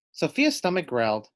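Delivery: ordinary delivery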